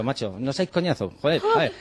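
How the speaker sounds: mock exasperation breaking into a laugh; half-laughing, half-scolding the kids; riendo fastidiado. Su respuesta a los chicos, riendo. (riéndose mientras regaña en broma)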